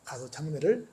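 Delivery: 낮고 작게 가라앉은 전언; 나직하고 조심스럽게 체념시키듯; 비보를 전하는 사람. Retold or imitated. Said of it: "죽었다는 비보를 전달을 합니다". (조용히 가라앉은 목소리로 전하는 비보)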